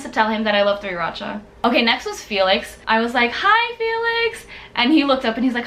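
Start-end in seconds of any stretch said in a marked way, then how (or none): 3.3-4.5 very high, drawn-out retold hello; excited fan greeting. Fan greeting Felix at a signing, high and excited. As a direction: sing out the greeting in a high squeal.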